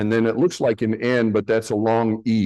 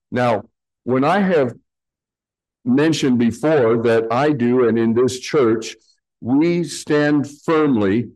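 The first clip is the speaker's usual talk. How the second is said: ordinary talk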